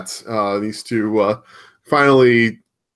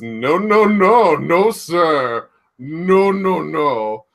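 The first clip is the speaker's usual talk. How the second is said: raised, insistent acted protest; mock defiant refusal; 4 semitones higher, much slower than usual. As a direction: play a wrestler refusing to lose. Acting out Roman's defiant refusal.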